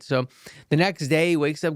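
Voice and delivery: ordinary delivery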